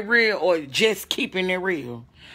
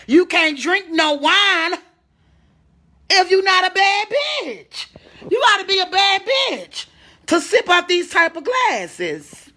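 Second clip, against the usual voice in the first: sassy boast; 7 semitones higher than usual. Real, not acted. Streamer bragging to viewers about wine glass, sassy high. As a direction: show off the glass with sass.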